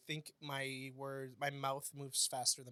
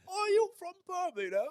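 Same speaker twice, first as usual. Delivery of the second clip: imitating a drunk British question; 7 dB louder than usual. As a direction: ham up the drunk Brit's question. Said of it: "I wish, like".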